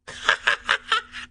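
high airy burst of laughter; hearty laugh. A narrator's reading. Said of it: "袁老四开怀大笑".

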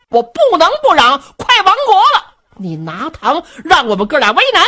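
fuming brother shouting back that he must yell; loud protest. A narrator's reading. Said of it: "您别嚷好不好".

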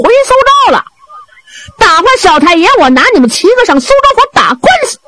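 high-pitched taunting storyteller's shout; calling out a challenge. A narrator's reading. Young He Renjie challenges the gang from the house, high declamatory call. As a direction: proclaim it high to the enemies inside. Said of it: "贺仁杰在房中就喊上了".

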